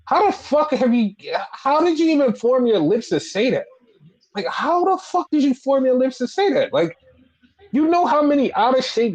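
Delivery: high, disbelieving rant; incredulous outburst. Real, not acted. Mocking a wrestler's promo line.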